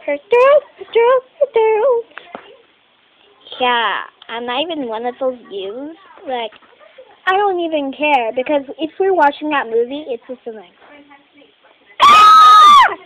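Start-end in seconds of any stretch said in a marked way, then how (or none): 0.1-2.0 high-pitched, mock repeated complaint. Kid echoing talk of a sore throat, high mock voice. 12.0-13.0 high, loud pretend horror scream. Kid playing girl in horror movie, high pretend scream. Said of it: "the girl's gonna scream again".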